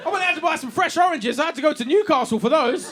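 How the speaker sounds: ordinary talk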